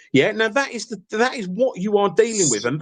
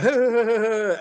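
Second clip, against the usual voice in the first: imitated jeering chuckle, pitched up; mocking laughter; 4 semitones higher than usual. Retelling how they laughed at him, mock laughter. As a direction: mimic a group's cruel laughing. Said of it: "they'd be laughing in the background".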